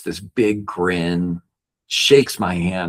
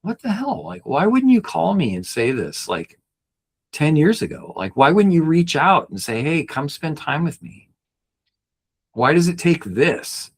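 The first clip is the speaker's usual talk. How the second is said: mock angry outburst; 9 semitones higher than usual. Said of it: "I wanted to yell at him".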